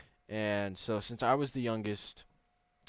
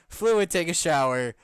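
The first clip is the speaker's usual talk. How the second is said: higher, louder mock announcement; mock loud read; 6 semitones higher, 8 dB louder than usual. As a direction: belt it out as a joke. Reading a funny comment aloud.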